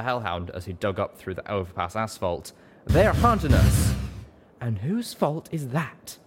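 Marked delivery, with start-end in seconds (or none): 2.8-3.9 much higher, louder, slow; Calamity shouting a warning. A narrator's reading. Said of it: "Calamity shouted". 4.6-6.2 higher, slow retort; loud quip. A narrator's reading. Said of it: "she quipped back loudly".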